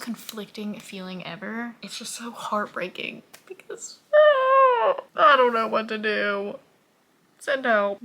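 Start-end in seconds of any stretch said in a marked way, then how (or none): 4.1-5.0 high, loud anguished wail; anguished scream. Real, not acted. Reader overwhelmed by a heartbreaking book, anguished scream. 5.2-8.0 loud, distraught outburst; loud despairing wail. Real, not acted. Reader at a loss over a heartbreaking book, despairing wail.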